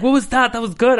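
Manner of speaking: high, loud delighted praise; thrilled, like she found it; excited teacher. Retold or imitated. Drama teacher praising the angry take, high and loud. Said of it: "She's like".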